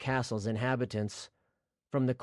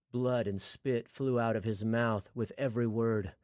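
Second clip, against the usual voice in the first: ordinary delivery, nothing marked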